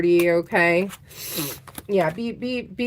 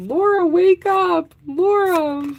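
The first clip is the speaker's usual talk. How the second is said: playful wake-up call; 9 semitones higher than usual. Real, not acted. Calling out to absent listener.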